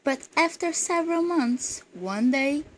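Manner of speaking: measured, projected verse reading; poem recitation. Acted. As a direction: read the poem aloud, letting each couplet's end fall. Reads a poem aloud.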